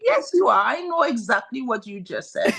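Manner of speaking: high, laughing back-and-forth; kids denying and teacher insisting. Retold or imitated. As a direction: play both sides with a laugh. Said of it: "And the kids are like".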